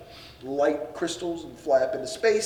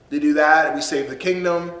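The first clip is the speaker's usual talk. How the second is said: normal talking voice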